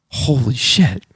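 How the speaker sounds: hushed, drawn-out amazement, acted out; retold stunned awe. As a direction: imitate someone slowly realizing who this is. Magician stunned at seeing George.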